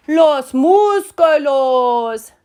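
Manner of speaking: high, loud, very slow proclamation; grandly stretched Spanish cheer; chorused announcement. Acted. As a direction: belt it out like a whole gang. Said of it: "said all the papitos".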